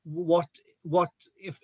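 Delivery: ordinary talk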